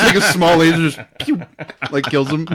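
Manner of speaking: ordinary talk, nothing marked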